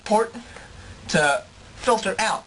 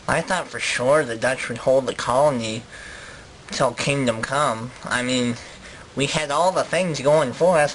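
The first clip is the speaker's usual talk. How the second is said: low voice of a regretful colonist; Dutch interviewee; 9 semitones lower than usual. Acted. Dutch colonist explains the loss to an interviewer, low and rueful. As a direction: play a let-down colonist, pitched low.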